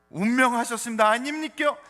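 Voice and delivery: loud, high-pitched Gyeongsang dialect imitation; Gyeongsang accent. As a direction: put on a punchy Gyeongsang accent. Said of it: "경상도 분들은".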